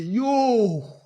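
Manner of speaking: loud high exclamation of shock. Retold or imitated. Telling friends about the news, a loud shocked exclamation. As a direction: blurt it out in disbelief.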